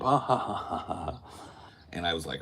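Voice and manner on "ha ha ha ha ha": loud, high imitated belly laugh; hearty mock laugh. Police woman laughing it up with tourists, mock hearty laugh. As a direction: ham up the cop's big laugh. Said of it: "laughing it up".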